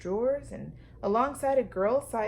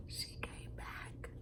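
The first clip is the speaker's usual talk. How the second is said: hushed delighted aside; whisper; 16 dB quieter, slower than usual. Real, not acted. Reader whispering happily to the children. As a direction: breathe it softly. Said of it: "whispered aside to listeners".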